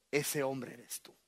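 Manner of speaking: low, slow grave verdict; lightly voiced prophet accusing; solemn prophet. Prophet Natán tells David a low, slow verdict.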